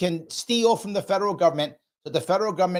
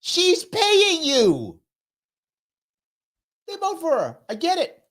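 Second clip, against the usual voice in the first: mock-bright sarcasm; 11 semitones higher, slower than usual. Sarcastic high-voiced answer.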